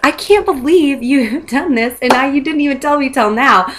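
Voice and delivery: high, laughing retold disbelief. Telling a friend over lunch, laughing in disbelief. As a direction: laugh through it, half scolding a friend. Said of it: "we were laughing about it".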